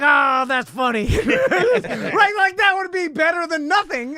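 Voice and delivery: put-on high, loud, laughing drunk fan; tipsy excited fan. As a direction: cackle along like a tipsy spectator. Imagines drunk fan at halftime.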